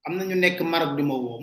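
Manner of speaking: ordinary talk, nothing marked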